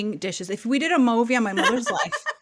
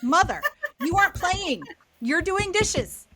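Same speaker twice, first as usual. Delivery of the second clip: higher, exasperated telling-off of mom; mock scolding; 6 semitones higher than usual. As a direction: lay down the rules to mother, fed up. Kids scolding mom over game.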